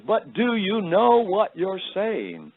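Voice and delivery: high, slow British lady imitation; insistent British woman, pitched well up; heavy British accent. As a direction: press the question slowly in a put-on British accent. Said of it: "in her heavy British accent".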